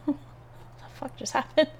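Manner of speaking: startled whisper. Real, not acted. Startled by a sudden noise.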